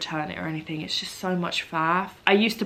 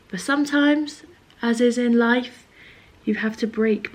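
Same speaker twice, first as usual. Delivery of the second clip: higher, louder life-lesson pronouncement; mock philosophical; 5 semitones higher, 4 dB louder than usual. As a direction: announce a grand truth, tongue in cheek. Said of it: "Getting very philosophical on you".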